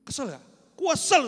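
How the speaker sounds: normal talking voice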